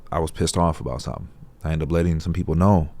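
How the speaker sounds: ordinary talk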